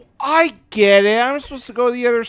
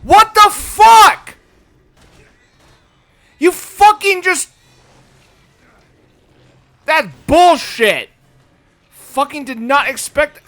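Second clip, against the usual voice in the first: furious shout; 6 semitones higher, 8 dB louder than usual. Real, not acted. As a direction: scream in shocked fury. Player startled and raging at game.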